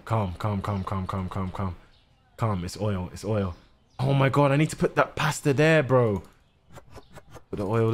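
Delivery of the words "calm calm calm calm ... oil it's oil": soft, low murmur to himself; calm yourself down under your breath; quiet self-soothing. Real, not acted. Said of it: "calming himself after a mistake".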